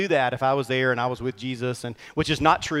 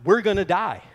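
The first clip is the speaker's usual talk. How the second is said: raised, higher mock yell of panic; retold yell; 5 semitones higher, 5 dB louder than usual. Dad yelling to the captain in the storm, retold mock yell. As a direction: call out the fear as Dad did, half-acted. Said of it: "Dad turning to Captain Mac and yelling".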